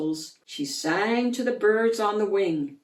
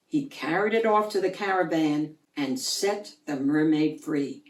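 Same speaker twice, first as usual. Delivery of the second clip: ordinary delivery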